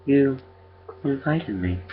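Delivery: high, eerie creature mimicking a child. A narrator's reading. Creature tells the father who it is, high and eerie. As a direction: pitch it up, evenly and uncannily.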